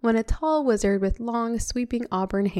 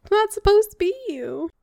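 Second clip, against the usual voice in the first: dismayed protest; 13 semitones higher, 3 dB louder than usual. Real, not acted. Reader protests the reveal.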